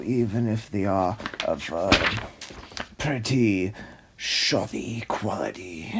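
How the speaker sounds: low, disdainful art snob; snooty connoisseur. Acted. Art snob dismissing the storyboards, low and disdainful. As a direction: sniff at it like a pompous critic.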